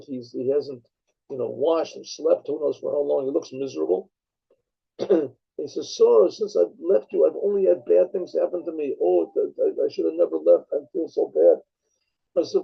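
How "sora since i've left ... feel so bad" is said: usual speaking voice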